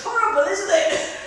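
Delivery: half-laugh while struggling; strained laughing. Real, not acted. Instructor struggling with the hard leg hold, strained laugh.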